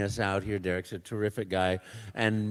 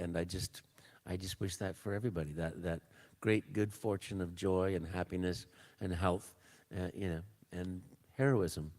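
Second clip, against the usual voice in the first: soft and sincere; 4 semitones lower, 9 dB quieter than usual. Real, not acted. Heartfelt closing wish.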